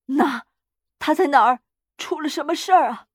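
jolted awake in fright; panicked alarm. A narrator's reading. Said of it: "他惊恐的跳了起来".

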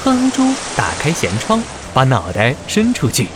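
ordinary delivery

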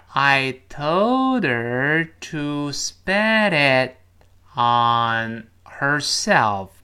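very slow, word by word; teacher reading it slowly for pupils to copy. Shown as a demonstration. 老师放慢读给学生听，很慢. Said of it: "我们放慢一点".

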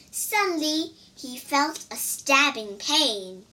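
ordinary delivery, nothing marked

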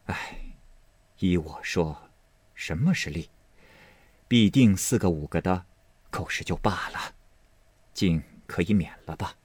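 soft, subdued Lady Wang weighing it up; pondering. A narrator's reading. Lady Wang answering Fengjie after some thought, quietly pondering. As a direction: begin on a faint sigh and speak quietly. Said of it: "想了一想".

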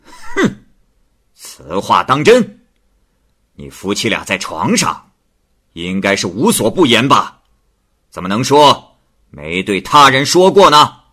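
loud, sharp magistrate; angry. Acted. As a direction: snort and cross-examine her. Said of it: "吴太守发怒说". (太守发怒质问王氏)

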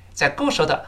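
ordinary talk, nothing marked

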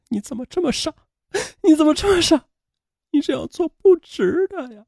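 high, soft tearful lament; sobbing. A narrator's reading. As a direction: plead through sobs, voice pitched right up. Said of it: "她哭着差点晕了过去". (哭着反复念叨)

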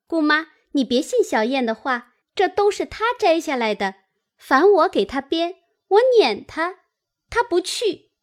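high-pitched, teasing tattling; playful teasing. A narrator's reading. Girl jokingly blames her friend to the aunt, high and teasing. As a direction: tell tales on her lightly, pitched high. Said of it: "婴儿笑道".

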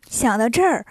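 usual speaking voice

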